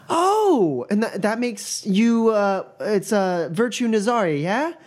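high, excited stammer; starstruck student. Acted. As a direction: gasp at meeting royalty. GM voices Mila.

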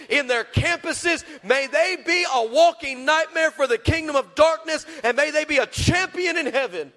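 rising, ringing prayer over the crowd; fervent prayer. Acted. Preacher praying over his congregation, fervent and building. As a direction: pray it out with full faith, building.

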